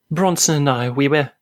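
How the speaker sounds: a hint of a shaken actress confessing; tearful confession. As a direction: confess it, barely holding together. Actress confesses after sobbing.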